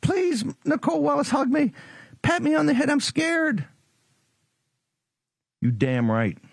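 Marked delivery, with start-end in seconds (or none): 0.0-3.7 much higher, whiny mock-frightened voice, mocking the opponent; squeaky pretend terror begging for a hug; mock scared whimper. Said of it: "What are you afraid of, Donnie?".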